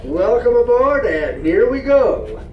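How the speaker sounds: booming conductor's welcome announcement; train announcer. Acted. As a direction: welcome the passengers aboard, announcer style.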